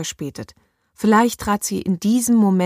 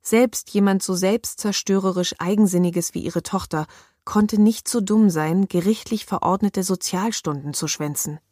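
usual speaking voice

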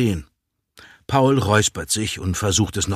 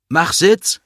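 high, louder command rising at the end; dog command; 8 semitones higher, 6 dB louder than usual. A narrator's reading. Owner tells the dog to sit, commanding. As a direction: call out a brisk order to a dog.

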